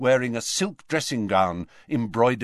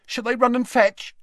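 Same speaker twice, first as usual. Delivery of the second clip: frightened; 12 semitones higher, 4 dB louder than usual. A narrator's reading. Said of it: "looking very frightened".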